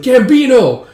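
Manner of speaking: high, loud shout of a name; calling out. Acted. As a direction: shout for a comrade in battle. Calling out to Gambino in battle.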